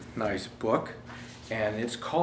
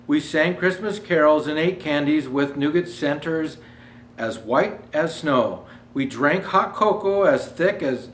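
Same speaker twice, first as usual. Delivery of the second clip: put-on storybook reading for children; gentle read-aloud to kids; story narration; 2 semitones higher, 4 dB louder than usual. Reads picture book aloud.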